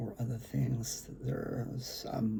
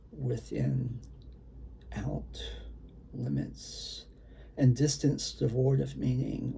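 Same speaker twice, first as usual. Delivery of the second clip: dramatic spoken-word delivery, some lines punched louder; brooding performance-poet voice; poetry reading; 4 dB louder than usual. Acted. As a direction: read it like a beat poet at an open mic. Reads his own poem aloud.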